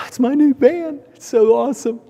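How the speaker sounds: high, delighted mock pride; proud excited self. Telling wife and kids, high proud gushing. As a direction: gush over the new van like a kid. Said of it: "I go".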